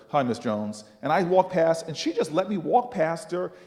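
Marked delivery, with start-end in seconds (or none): 0.1-0.8 low, grudging grumble; grumbled greeting. Retold or imitated. Grumbling at Miss Jones while walking past, grudging. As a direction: grumble it out, pitched right down. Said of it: "I just grumble at her".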